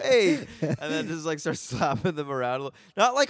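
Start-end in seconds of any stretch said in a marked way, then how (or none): 0.0-0.5 high, loud "Hey"; playful imitation; mock greeting. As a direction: slap greeting. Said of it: "I just want to be like".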